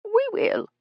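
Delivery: very high-pitched, quick promise; assuring. A narrator's reading. Ella assuring the guide, high-pitched. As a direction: pipe up to assure him. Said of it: "Ella assured him".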